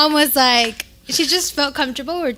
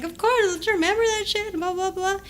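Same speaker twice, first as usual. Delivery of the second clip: casual retort; 6 semitones higher, 3 dB quieter than usual. Retold or imitated. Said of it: "I was like".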